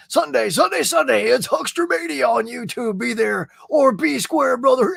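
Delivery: booming wrestling promo announcer. Pretend. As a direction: hype it like a monster truck ad. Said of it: "I can hear the announcer voice".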